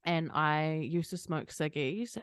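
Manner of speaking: ordinary talk, nothing marked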